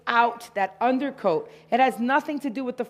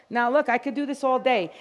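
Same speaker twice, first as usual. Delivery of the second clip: normal talking voice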